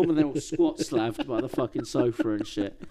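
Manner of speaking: ordinary talk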